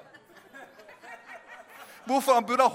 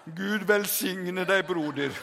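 slow, lowered, unctuous blessing; mock-pious blessing; 4 semitones lower, slower than usual. Brothers bless the drowning Peter, slow mock-pious voice. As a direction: drag it out with sham piety.

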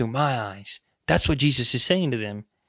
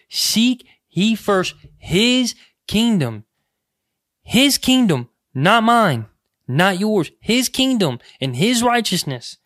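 fervent preaching; 5 semitones higher, slower than usual. Acted. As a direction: proclaim it to the congregation at full force. Preaching at full force.

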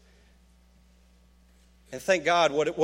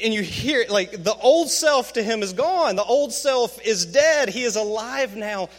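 ordinary delivery

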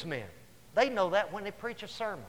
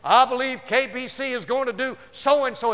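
mock prophet voice; 5 semitones higher, 8 dB louder than usual. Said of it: "Somebody said".

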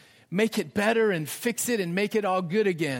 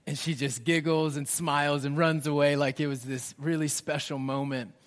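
normal talking voice

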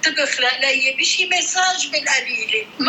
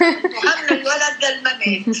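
laughing. Real, not acted. Repeating proverb while laughing.

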